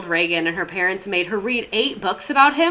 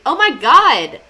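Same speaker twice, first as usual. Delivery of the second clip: loud, higher burst of surprise; delighted surprise; 4 semitones higher, 10 dB louder, slower than usual. Real, not acted. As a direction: break off, loudly amazed. Surprised by a viewer's gift.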